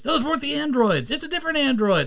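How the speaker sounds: high, urgent cartoon warning; hammed-up, high-pitched correction; mock alarm. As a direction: cry it out like a panicked anime hero. Voicing a warning from the show.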